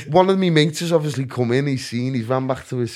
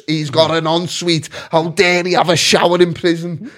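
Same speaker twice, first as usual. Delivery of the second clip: higher, hammed-up indignation; raised-voice mock outrage; mock outraged taxpayer; 5 semitones higher, 4 dB louder than usual. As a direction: protest as a scandalised member of the public. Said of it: "The taxpayers are gonna go nuts".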